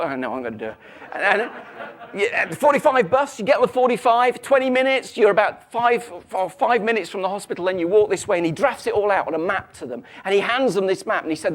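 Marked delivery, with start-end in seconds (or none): 1.1-8.4 louder, higher, busy rattle of directions; eager direction-giver. Acted. First Italian giving bus directions to panicking couple, busy and loud. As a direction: reel off the bus route eagerly. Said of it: "The first Italian steps forward".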